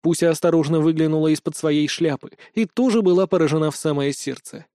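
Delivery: ordinary delivery, nothing marked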